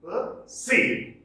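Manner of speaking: "Perceive" split, light "per", high falling "CEIVE"; emphatic per-CEIVE for "Perceive"; stress on second syllable. Shown as a demonstration. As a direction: separate "Perceive" and hit the second part. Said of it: "Second syllable stressed".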